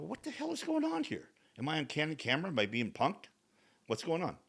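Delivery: high, incredulous outburst without the volume; retold exasperation. Left alone in the therapist's office, exasperated. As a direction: squeak up in baffled mock outrage, kept soft. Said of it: "now I'm getting frustrated".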